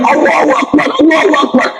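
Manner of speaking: high joyful cry of praise; joyful shout. Retold or imitated. Blind Egyptian man shouting praise after healing, high and joyful. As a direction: call out to God in amazed joy. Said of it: "dia teriak".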